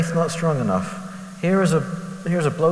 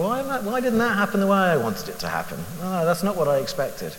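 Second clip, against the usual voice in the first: high, protesting whine at God; mock complaint; 6 semitones higher than usual. Preacher acting out his own prayer when let down, protesting. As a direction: play up the disappointment, pitch leaping up.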